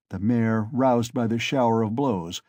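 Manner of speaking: usual speaking voice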